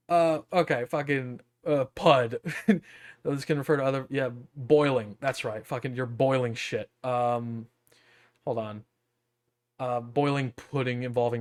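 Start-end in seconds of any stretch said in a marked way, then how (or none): none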